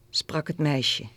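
usual speaking voice

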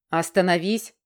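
commanding king's call, a bit agitated. Acted. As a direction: stop her with a king's command. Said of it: "Чуть взволнованный голос короля".

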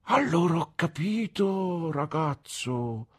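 a simple man's sudden discovery; exclaiming. A narrator's reading. Old man suddenly grasps the secret, exclaiming. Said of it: "esclamò mister Dick".

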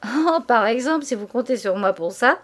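loud amused outcry; merry mock protest; gaily exclaiming. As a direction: protest while clearly enjoying it. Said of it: "Elle s'écria très égayée".

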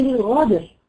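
الغادر for قادر, with a gargled gh; qaf as ghain. Shown as a demonstration. Teacher quoting Sudanese speakers, qaf as ghain. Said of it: "وبعض البلدان ينطقها غين".

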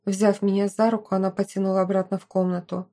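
ordinary delivery

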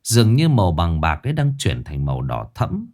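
ordinary delivery

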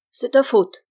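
loud, sharp blaming retort; angry reproach. Acted. Grumpy host blaming his guest, angrily loud. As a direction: snap the blame at him. Host scolds Bruno at bedtime.

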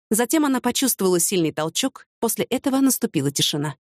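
usual speaking voice

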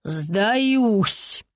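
long-drawn moan of defeat; groaned surrender. A narrator's reading. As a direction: groan it out, giving up in despair. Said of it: "поднял обе руки вверх и простонал". (стон отчаяния)

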